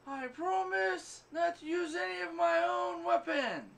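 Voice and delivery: high, slow proclaimed vow; mock heroic shout. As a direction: announce the promise up high like a hammy hero. Retelling the Deadpool movie line.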